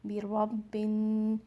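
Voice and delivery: बिरब्बिन slow with a held nasal न; nasal ghunna. Shown as a demonstration. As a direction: hum the न in बिरब्बिन. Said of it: "उसको गुन्ना के साथ पढ़ना है".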